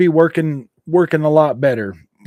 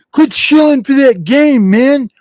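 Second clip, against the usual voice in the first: mock indignant shout; 9 semitones higher, 8 dB louder, slower than usual. Mock complaint at chat.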